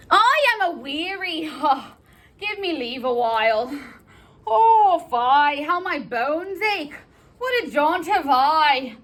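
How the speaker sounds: high, wailing complaint of aching bones; exhausted nurse. Acted. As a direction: moan theatrically about your tiredness. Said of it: "I'm exhausted".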